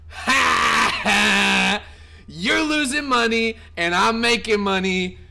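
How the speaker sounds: loud mocking ha ha; taunting laugh. Real, not acted. Streamer jeering at a salty viewer in chat, taunting. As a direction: jeer at the loser.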